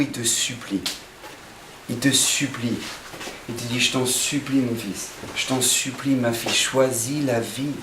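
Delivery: tender pleading God. Acted. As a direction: beg gently, like a loving father. Voices God pleading softly. (Dieu qui supplie doucement, plus grave et lent)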